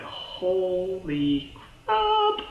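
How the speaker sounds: very slow, drawn-out, high shock; stunned exclamation. Acted. Viewer seeing the alien in the vault, acted astonishment. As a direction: stretch each word out in amazement.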